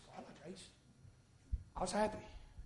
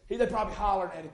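ordinary talk

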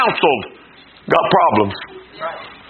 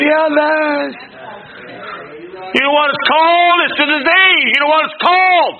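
raised, fervent preacher's call; preaching; 11 semitones higher than usual. Acted. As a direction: build it up like a revival preacher.

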